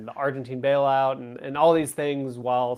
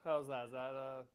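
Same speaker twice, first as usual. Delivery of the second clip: barely audible, far from mic; faint voice on a weak mic; faint audio; 3 semitones lower, 20 dB quieter than usual. Real, not acted. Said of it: "Not much better".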